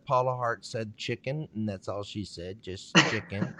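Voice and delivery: normal talking voice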